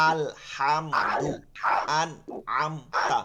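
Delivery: আলহামদু stretched out very slowly, then আন and আমতা split apart; very slow syllable reading. Shown as a demonstration. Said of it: "দেখেন প্রত্যেকটা জবর জের পেশ দিয়া".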